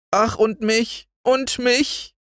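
very high, a hint of tearful begging; lightly voiced tearful plea. Arndt begging to come too, mildly tearful. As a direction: plead like a small boy near tears. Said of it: "ganz in Tränen aufgelöst".

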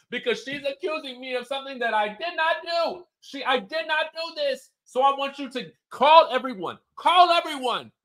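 hammed-up frantic diva demanding police; Lisa, hysterical. Lisa to her husband Sean, demanding he call the police. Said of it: "Lisa's like".

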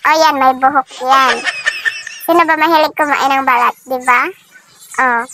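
talking while eating egg; chewing between words; mouth full, chewing. Real, not acted. Seen 0: Eats balut, grimaces with food in mouth, hand near mouth.

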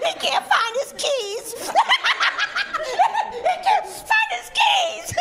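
high giggly stoner voice, laughing through it; stoned giggling. Retold or imitated. Comic mocking a stoned young man who lost his keys, high giggling.

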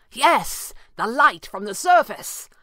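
high, loud, eager shout; excited cry. A narrator's reading. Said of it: "Pavli cried excitedly".

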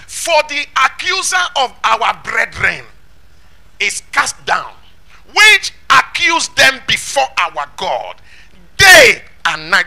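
slow, measured reading building to a loud, stretched DAY; weighty pulpit reading with drawn-out words; scripture declamation. Acted. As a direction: declaim the verse word by word. Reads scripture in declaiming style.

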